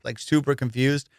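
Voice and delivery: ordinary talk, nothing marked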